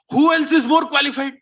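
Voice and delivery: loud, high challenge; indignant retort. Acted. Kaikeyi answers Mantra about Ram, indignant. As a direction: throw the question back forcefully.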